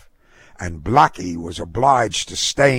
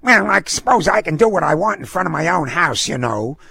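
high, quick indignant retort; defiant dog owner; 8 semitones higher, faster than usual. A narrator's reading. Dog owner answers snooty neighbor, defiant. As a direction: fire back fast and high-pitched. Voices Missus Harrigan replying.

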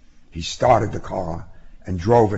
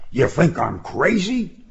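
higher-pitched indignant outburst; exploding; 8 semitones higher than usual. A narrator's reading. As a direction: mildly explode at the accusation. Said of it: "he exploded".

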